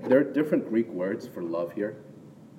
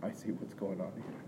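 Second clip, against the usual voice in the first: quiet realization; 13 dB quieter than usual. Retold or imitated. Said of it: "Peter's like".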